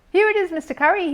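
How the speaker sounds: high, loud eager call. A narrator's reading. Bear calling to the neighbour across the fence, eager. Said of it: "he called".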